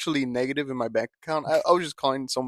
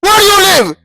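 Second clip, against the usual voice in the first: furious shout; 14 semitones higher, 12 dB louder, slower than usual. Pretend. Escalating mock call-center fight.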